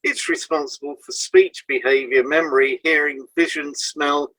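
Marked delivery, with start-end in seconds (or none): none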